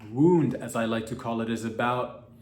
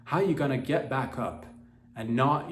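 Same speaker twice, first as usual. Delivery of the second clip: ordinary talk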